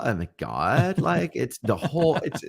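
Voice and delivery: mock valley girl, swooping pitch. Describing West Coast speakers, mock valley girl. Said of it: "everybody talks like a valley girl".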